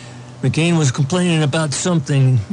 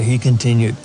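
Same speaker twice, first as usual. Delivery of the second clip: normal talking voice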